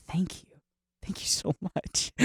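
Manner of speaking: soft, whispered gratitude; mock deeply touched. Painter receiving highest compliment, whispered mock gratitude. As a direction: whisper thanks, slowly, as if overcome.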